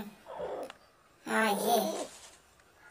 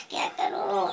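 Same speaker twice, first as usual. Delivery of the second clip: usual speaking voice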